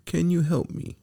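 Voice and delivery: very high, small begging voice; pleading puppet. A narrator's reading. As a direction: plead for rescue. Caged Pinocchio begs the fairy.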